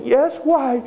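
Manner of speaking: high small innocent voice; little girl. Acted. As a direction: answer sweetly, unsuspecting. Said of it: "little Esmeralda said".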